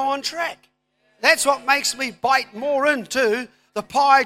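loud, rising sermon build; full-force preaching. Acted. Pastor rallying the congregation about the dream, loud preaching. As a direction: preach it out over the crowd.

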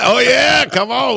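excited yell. Pretend. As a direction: cheer like you're in the stands. We'll go and just yell.

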